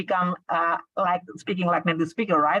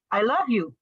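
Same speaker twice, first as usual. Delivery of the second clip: "love" raised as the peak, "you" falling after it; stress on LOVE. Shown as a demonstration. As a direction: hit the verb "love". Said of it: "you stress on the verb".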